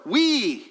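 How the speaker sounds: "we" high and drawn out; emphatic WE. Shown as a demonstration. Preacher shows Paul's emphasis, "we" strongly stressed. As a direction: punch "we" high, letting it fall. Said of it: "He's emphasizing that word".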